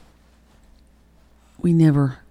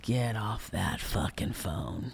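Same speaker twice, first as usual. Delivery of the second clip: retold furious order; 5 semitones lower, 8 dB quieter, slower than usual. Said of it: "I stormed back".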